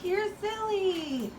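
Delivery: slow high teasing coo. Real, not acted. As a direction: tease the bird gently. Teasing bird.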